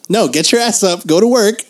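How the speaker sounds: normal talking voice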